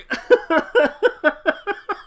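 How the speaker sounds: high helpless laughing; real uncontrollable laughing fit; crying with laughter. Real, not acted. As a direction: laugh until the tears come. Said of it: "I'm dying and I'm crying".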